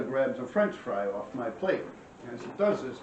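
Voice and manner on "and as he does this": ordinary delivery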